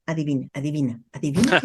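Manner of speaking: a hint of a playful child. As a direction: tease like a playful kid dodging the question. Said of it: "desde el niño".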